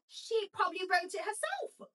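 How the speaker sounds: high hammed British accent; mock British accent. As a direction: do a posh British Victoria, hammed-up. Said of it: "My British accent is typically top tier".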